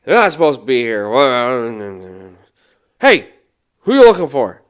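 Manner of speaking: loud, quick outburst, then a hollered question; Fred's shouted challenge. Retold or imitated. Fred challenges a stranger at the house, hollering. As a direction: holler at an intruder. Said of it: "And he goes".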